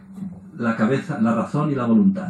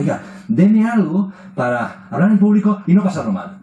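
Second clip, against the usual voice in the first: higher, louder request to the doctor; pleading patient; 7 semitones higher, 8 dB louder than usual. Retold or imitated. Patient asking the doctor for a pill, higher and louder. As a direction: ask the doctor for an easy fix. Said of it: "van al médico y le dicen".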